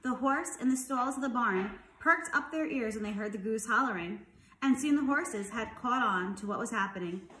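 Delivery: plain reading voice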